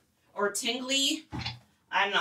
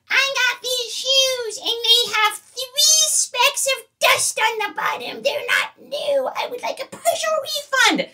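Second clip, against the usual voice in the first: complaining buyer. Acted. Seller mimics a buyer griping over dusty shoes, in a high complaining voice. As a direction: put on a fussy high-pitched complainer demanding satisfaction.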